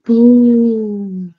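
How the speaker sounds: loud boo from the throat; growled boo. Acted. Jeff showing the monster how to boo, growled. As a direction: growl it to scare. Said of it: "Jeff growled deep down into his throat".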